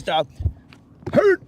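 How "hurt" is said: sudden high yelled cry; yelped high and loud; mock yell. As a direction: blurt it out loud as if bracing. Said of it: "be like this".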